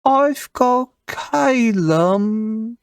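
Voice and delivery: high, slow British elf; Calum Dawn Warden. Shown as a demonstration. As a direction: draw out a British elf introduction. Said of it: "to go back over them".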